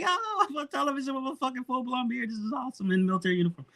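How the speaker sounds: high, breathless giddy rush; thrilled. Retold or imitated. Telling a friend about his TV moment, giddy. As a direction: gush like a kid seeing himself on TV. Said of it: "I was like".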